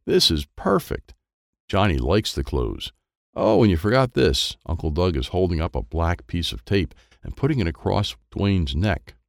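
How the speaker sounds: usual speaking voice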